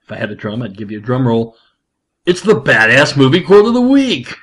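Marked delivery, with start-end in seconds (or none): 2.3-4.3 loud, raised announcer call, swooping up; big announcer. Pretend. Host announcing the weekly movie quote, big announcer voice. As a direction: belt out the segment title like a hype announcer. Announces the show's segment after build-up.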